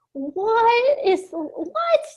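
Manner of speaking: high, confused double take from a stranger on the phone; mock bewildered what, rising; baffled caller. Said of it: "people on the phone, like".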